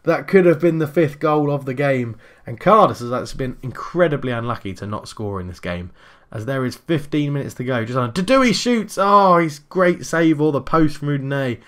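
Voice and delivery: brisk running match commentary; play-by-play commentary. Acted. Live football game commentary.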